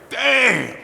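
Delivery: high, loud awe; amazed exclamation. Retold or imitated. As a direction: sound blown away. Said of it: "Everybody's like".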